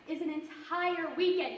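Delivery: usual speaking voice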